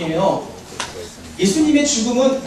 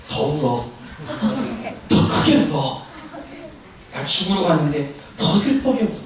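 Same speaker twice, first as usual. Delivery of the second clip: imagined demand; 6 semitones lower, 3 dB quieter than usual. Acted. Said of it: "목사님 같으면".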